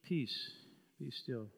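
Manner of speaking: soft, falling "Peace, be still"; quiet command. Shown as a demonstration. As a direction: speak calm over the storm gently. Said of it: "There is life in repeating".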